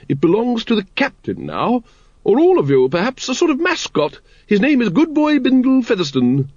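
high-pitched, gushing lady of rank; hearty grand lady. Acted. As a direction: introduce the pet in a high, ladylike voice. Voices Lady Ramkin.

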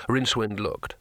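ordinary delivery, nothing marked

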